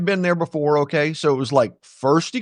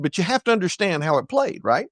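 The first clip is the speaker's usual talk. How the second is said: normal talking voice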